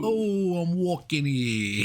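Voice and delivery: high, stretched-out New York parody; mock New York accent. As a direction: ham up a brash New Yorker. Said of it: "Do an Andrew Dice Clay impression".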